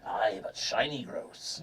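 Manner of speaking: slow, low sea-dog voice; salty sailor. Acted. Sailor defending his shiny blennies, slow low salty voice. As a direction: retort slowly like an old pirate.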